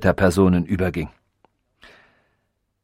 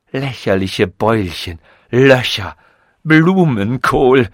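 scornful ranting; 2 semitones higher, 5 dB louder, slower than usual. A narrator's reading. Robot insults the narrator's lumpy head, scornful. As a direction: spit out each insult with contempt.

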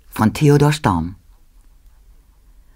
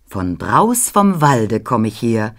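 usual speaking voice

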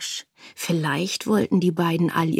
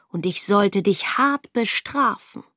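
plain reading voice

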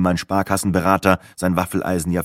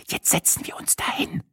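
a whisper, much softer; 10 semitones higher, 8 dB quieter than usual. A narrator's reading. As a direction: hush it to her friend so nobody hears. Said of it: "tuschelte Sina".

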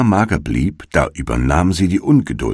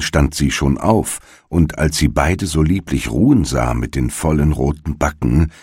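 ordinary delivery, nothing marked

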